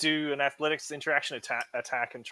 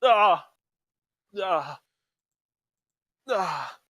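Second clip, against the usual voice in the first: loud frustrated cry then fading groans; dismayed groans; 6 semitones higher, 6 dB louder, much slower than usual. Real, not acted. Player groaning at a bad roll, dismayed cry.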